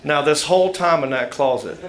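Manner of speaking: ordinary talk, nothing marked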